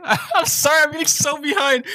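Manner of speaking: high, loud laugh through the words; laughing apology. Real, not acted. Streamer apologises to chat for lagging, laughing high and loud. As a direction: giggle out an apology.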